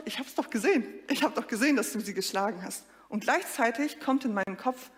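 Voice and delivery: ordinary talk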